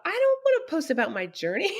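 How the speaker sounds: high-pitched mock whine of refusal; reluctant protest. Widow retelling her reply to the Lord, reluctant protest. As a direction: balk at it, voice pitched right up. Retells balking at the Lord's request.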